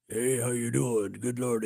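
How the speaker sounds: put-on low, booming old-school DJ; radio DJ voice. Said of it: "all these guys had radio voices".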